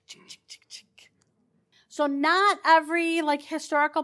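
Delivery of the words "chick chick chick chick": very soft, unvoiced "chick" clicks; whispered bullet clicks. Guide tells visitors what they can still hear, whispering the clicks. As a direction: whisper the clicks like a faint echo. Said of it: "you can hear the chick".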